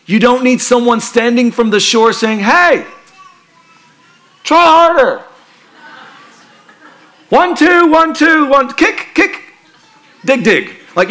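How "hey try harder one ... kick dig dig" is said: mock shouting coach. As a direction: bark swim counts like a pushy coach. Useless coach yelling from shore.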